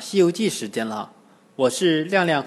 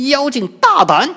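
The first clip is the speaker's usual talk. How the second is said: high, loud rebuke; fierce challenge; 10 semitones higher, 6 dB louder than usual. A narrator's reading. Wukong confronts the disguised demon, fierce shout.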